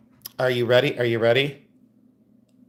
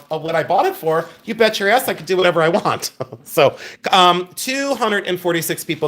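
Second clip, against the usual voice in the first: ordinary talk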